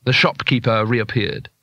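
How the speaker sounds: normal talking voice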